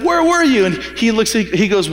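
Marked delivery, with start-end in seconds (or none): 0.0-0.6 high, anxious call from the parents; worried parents. Retold or imitated. Mary and Joseph finding the boy, high and anxious. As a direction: ask it like a frightened mother finding her son. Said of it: "they're like".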